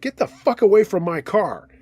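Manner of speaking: indignant retold shout; retold angry yell. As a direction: yell it like warning off a car thief. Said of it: "I yelled something like".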